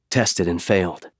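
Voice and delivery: usual speaking voice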